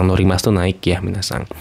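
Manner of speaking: ordinary talk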